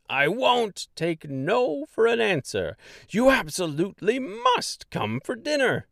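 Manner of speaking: won't hear a no; insistent jolly mayor. Acted. Mayor insists Dorothy come to dinner, cheerfully firm.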